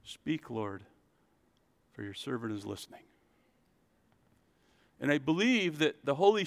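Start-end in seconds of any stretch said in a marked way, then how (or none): 0.0-3.0 soft, humble whisper of a prayer; a servant quietly offering to listen; hushed prayer. Shown as a demonstration. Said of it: "just go to the Lord and say".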